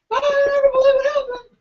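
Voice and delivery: shrill, loud, crying mock hysteria; hysterical grandma. Grandma screaming down the phone, mock hysterical and loud. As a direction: wail it like a frantic grandma.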